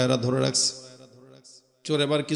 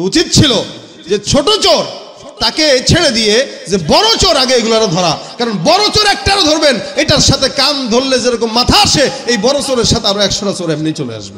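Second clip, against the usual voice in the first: loud, high, impassioned shouting to the crowd; full-force preaching; 9 semitones higher, 9 dB louder than usual. Acted. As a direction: drive the argument home at a shout. (গলা চড়িয়ে জ্বালাময়ী ওয়াজ)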